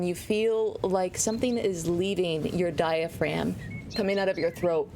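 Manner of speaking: ordinary talk, nothing marked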